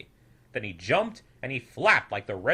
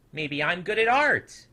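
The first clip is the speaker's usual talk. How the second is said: pleased discovery; 6 semitones higher, 6 dB louder than usual. A narrator's reading. Snoodle finds paints.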